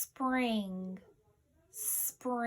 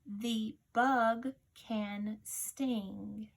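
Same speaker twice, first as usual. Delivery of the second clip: very slow and even dictation reading; teacher reads a sentence aloud slowly; much slower than usual. Shown as a demonstration. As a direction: read it slowly, word by word, for dictation. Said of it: "Your sentence is".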